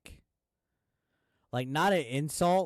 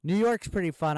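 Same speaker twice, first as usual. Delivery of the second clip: higher, louder burst of enthusiasm; excited; 6 semitones higher, 6 dB louder than usual. Real, not acted. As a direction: kick off the new story all fired up. New topic, burst of enthusiasm.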